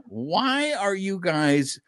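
usual speaking voice

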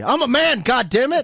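high, loud protest; indignant shout. Real, not acted. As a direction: insist like an offended grown man. Indignant protest about age.

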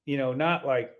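ordinary delivery

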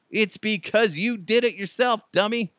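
high, teasing scold; high mock scolding; mocking wife. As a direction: tease him for his own fault. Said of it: "That's what my wife would say".

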